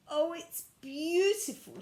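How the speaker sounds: high, loud and slow with delight. Acted. Miss Biscuit seeing her old cottage again, delighted and slow. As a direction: gasp it out in joy.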